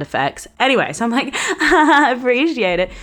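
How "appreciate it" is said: higher, louder sarcastic echo; laugh, then throw his words back; mocking laugh. Mocking her housemate's reply, high laughing sarcasm.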